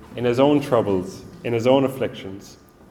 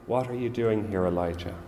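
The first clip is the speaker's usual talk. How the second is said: soft, still small voice; God asking Elijah softly, in a gentle near-whisper; 3 semitones lower, 6 dB quieter than usual. A narrator's reading. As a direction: hush it down, tender and calm. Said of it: "in a still small voice saying".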